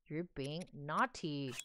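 drawn-out mock scold to a pet; slow scolding. Real, not acted. Scolding horse again, slower.

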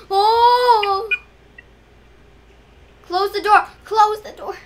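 high, loud frightened plea; frightened shout. Real, not acted. Frightened again in game.

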